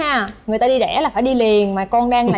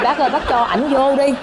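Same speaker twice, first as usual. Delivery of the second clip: pleading with a laugh; 3 semitones higher than usual. Retold or imitated. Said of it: "nó nói là".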